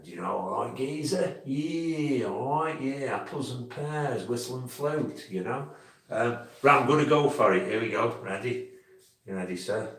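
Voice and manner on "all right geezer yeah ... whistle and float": lightly put-on East End Cockney accent; a hint of Cockney geezer; mild mock Cockney slang. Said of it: "bit East End".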